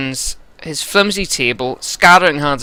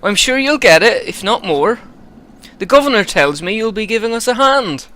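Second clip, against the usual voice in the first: higher, louder West Country steward; proud steward in a thick West Country accent; West Country Eirik; 10 semitones higher, 5 dB louder than usual. Acted. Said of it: "I think West Country for this guy".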